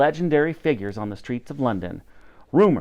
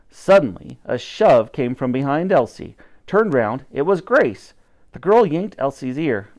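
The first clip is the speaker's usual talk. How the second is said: usual speaking voice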